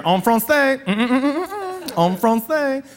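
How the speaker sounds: mock teacher insisting; French teacher. French teacher to student, mock insisting in French. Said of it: "Every day I was in there".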